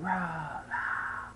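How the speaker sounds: breathy whispered mock scream; whisper yelling. Shown as a demonstration. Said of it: "whisper screams like".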